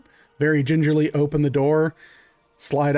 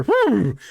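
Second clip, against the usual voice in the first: startled whoo; 7 semitones higher, 3 dB louder than usual. Acted. Horses startled, yelp heard.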